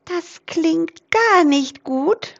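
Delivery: high, slow mouse voice stressing gar; a little mouse fretting, drawn out; squeaky mouse. Acted. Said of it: "piepste die kleine Waldmaus Rudi". (hohe, langsame Mausstimme)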